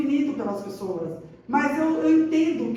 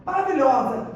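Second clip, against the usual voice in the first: normal talking voice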